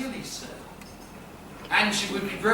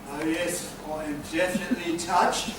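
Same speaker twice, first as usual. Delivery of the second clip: mock touched; 4 semitones lower, 6 dB quieter, faster than usual. Sarcastic reply to turkey story.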